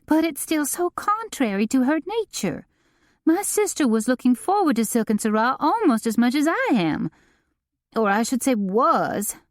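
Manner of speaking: a frantic, put-upon socialite; Delane voiced. A narrator's reading. Delane continuing, read by narrator.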